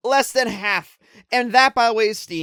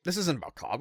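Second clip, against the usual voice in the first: usual speaking voice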